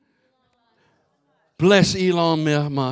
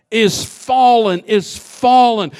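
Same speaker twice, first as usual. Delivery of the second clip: loud, slow proclamation of is fallen; mighty loud cry; 7 dB louder, slower than usual. Retold or imitated. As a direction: cry it out slowly like the angel. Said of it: "he cried mightily with a loud voice".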